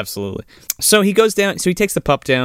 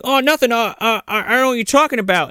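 higher and faster, flustered and caught out; nervous stammering denial; 11 semitones higher than usual. Retold or imitated. Clint lying to Sarge, nervous quick stammer. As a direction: stammer like a kid caught red-handed. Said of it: "And he's like".

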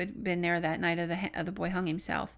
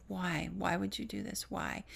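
retold quiet anguish; 9 dB quieter than usual. Retelling young man alone after funeral, quiet anguish. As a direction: ask it quietly, as if to oneself.

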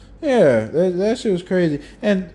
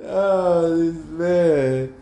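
laughing; much slower than usual. Real, not acted. Laughing at friend's joke.

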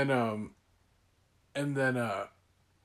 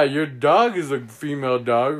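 normal talking voice

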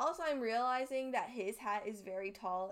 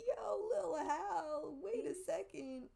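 imitating herself; 7 semitones higher, slower than usual. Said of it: "and I was like".

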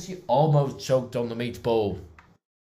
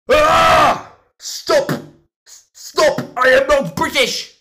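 very high, full-force scream; frantic screaming; 13 dB louder, much slower than usual. Acted. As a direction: scream in denial. Boss screams at his accent.

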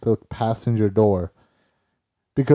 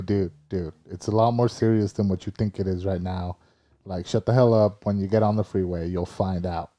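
softer, lower, tired; injured brother warning his sibling on the phone; hushed weary warning; 6 semitones lower, 6 dB quieter than usual. Retold or imitated. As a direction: talk low like you're hurting.

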